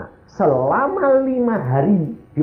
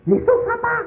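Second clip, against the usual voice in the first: baffled question. Retold or imitated. Husband baffled by her shouting.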